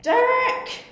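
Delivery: high, loud shout of a name; yelling across the room. Acted. Calling out loudly.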